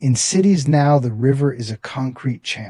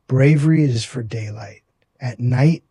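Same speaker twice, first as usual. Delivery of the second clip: ordinary delivery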